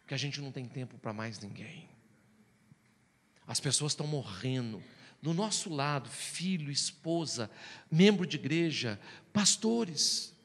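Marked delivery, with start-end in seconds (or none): none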